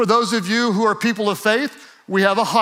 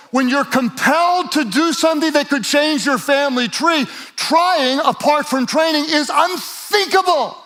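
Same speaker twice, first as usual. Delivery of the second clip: preaching at full force; 5 semitones higher than usual. Acted. Preacher urging the congregation to stop trying and start training. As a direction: drive it home to the congregation.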